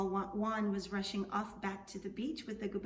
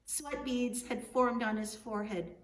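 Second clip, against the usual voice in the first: plain reading voice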